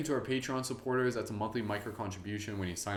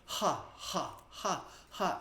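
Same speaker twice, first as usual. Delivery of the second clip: high breathy ha ha; exhale-focused ha ha; demo ha breaths; 8 semitones higher, 3 dB quieter, much slower than usual. Shown as a demonstration. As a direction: push short ha breaths out. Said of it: "So it's gonna sound like this".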